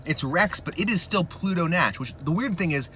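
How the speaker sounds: usual speaking voice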